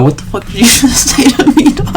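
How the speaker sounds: high, laughing "what the fuck" reaction; laughing mock outrage. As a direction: act shocked through a laugh. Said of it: "And be like".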